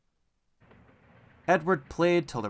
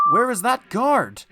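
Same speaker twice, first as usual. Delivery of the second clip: higher, louder worried question; anxious call; 9 semitones higher, 7 dB louder than usual. A narrator's reading. Edward waiting for the late guard, anxious and higher.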